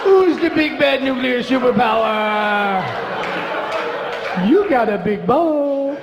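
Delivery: pat it on the head with a sing-song coo; baby talk. Acted. Mocking a proud nuclear state, slow cooing baby talk.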